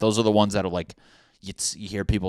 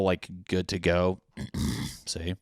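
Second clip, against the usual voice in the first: ordinary talk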